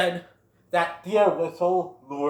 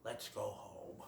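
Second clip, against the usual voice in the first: lower, much softer subdued voice; calm quiet father; 8 semitones lower, 19 dB quieter, slower than usual. Retold or imitated. Great Prince after calming down, soft and low. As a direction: let the anger go, softly. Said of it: "takes a deep breath".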